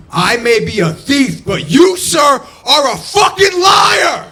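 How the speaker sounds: high-pitched, full-volume indignant shout; hammed-up righteous outrage, shouted high; morally indignant. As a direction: yell it like a wronged man making a public accusation. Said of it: "I got morally indignant".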